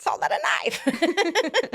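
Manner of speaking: playful Australian accent impression. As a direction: ham up a slow Australian movie line. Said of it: "Can you do an Australian accent for us".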